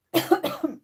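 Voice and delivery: hard choking cough; coughing fit. Real, not acted. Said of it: "seems that I'm choking now".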